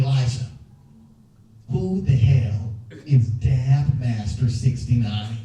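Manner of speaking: low, slow gruff dad. Retold or imitated. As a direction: drop low and drag it out like a stern father.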